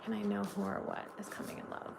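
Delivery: ordinary talk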